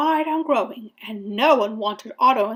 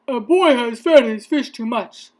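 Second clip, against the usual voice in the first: lightly voiced officer, loud report; a hint of policeman on the radio; 5 dB louder than usual. A narrator's reading. Policeman reporting to firemen, lightly voiced and loud.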